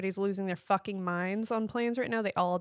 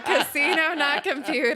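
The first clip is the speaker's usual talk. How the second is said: excited; 6 semitones higher, 4 dB louder than usual. Retold or imitated. Repeats slogan with delight.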